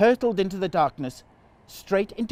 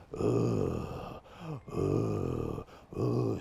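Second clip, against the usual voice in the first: deep, creepy raspy breaths; demon breathing; 7 dB quieter, much slower than usual. Shown as a demonstration. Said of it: "Do that again?".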